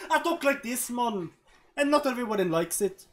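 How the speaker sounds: put-on Jamaican accent. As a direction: do your best rough Jamaican accent. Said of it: "do your best Jamaican accent".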